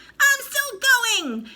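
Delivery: very high, very slow crone screech; screechy old grandma from the attic. A narrator's reading. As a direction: drag it out like a cackling hag. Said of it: "the old screechy voice".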